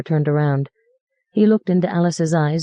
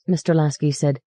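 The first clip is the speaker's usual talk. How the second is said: usual speaking voice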